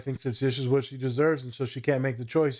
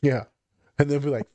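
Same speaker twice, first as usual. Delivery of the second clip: ordinary talk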